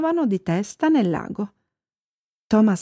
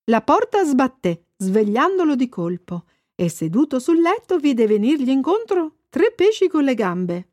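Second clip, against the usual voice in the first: ordinary delivery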